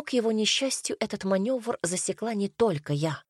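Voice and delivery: plain reading voice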